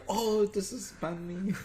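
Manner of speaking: high, slow show-host intro; presenter voice. Pretend. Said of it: "I should be like".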